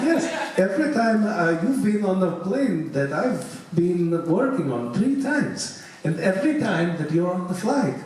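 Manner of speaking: put-on Eastern European accent. Flight attendant tells her lucky passenger about the Aurora, Eastern European accent. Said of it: "she was Eastern European".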